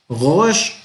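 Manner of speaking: "rosh" with a throaty French-like r, rising; half-French guttural R. Shown as a demonstration. Teacher warning Spanish speakers it is hard, guttural r in "rosh". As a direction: make the r of "rosh" guttural, not rolled. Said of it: "Es como medio afrancesada".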